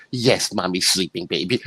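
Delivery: mock tender mother. Parodying the tear-jerker scene, soft slow mock comfort. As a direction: coo like a tearful parent. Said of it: "tear jerking thing".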